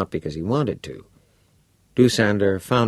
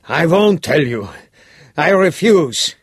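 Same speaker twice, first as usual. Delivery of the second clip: horrified refusal; 10 semitones higher, 8 dB louder than usual. Acted. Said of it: "unable to hide the horror he felt".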